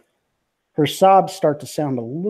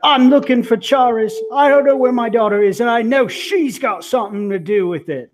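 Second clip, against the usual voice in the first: high, loud desperate father pleading; a father searching for his daughter, pushing hard; frantic father; 8 semitones higher, 3 dB louder than usual. Acted.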